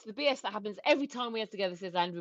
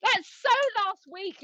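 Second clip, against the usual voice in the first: slow, high playful tease; teasing; 7 semitones higher, 5 dB louder, slower than usual. Real, not acted. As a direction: tease him about it. Teasing dismissal.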